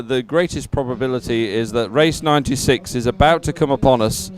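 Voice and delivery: normal talking voice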